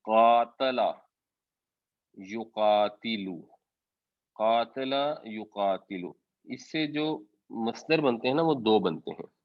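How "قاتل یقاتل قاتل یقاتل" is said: قاتل یقاتل drawn out slowly; slow careful demo. Shown as a demonstration. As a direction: spell out قاتل یقاتل syllable by syllable. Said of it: "باب مفاعلہ".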